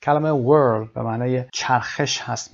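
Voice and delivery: ordinary talk, nothing marked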